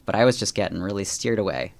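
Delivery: ordinary talk, nothing marked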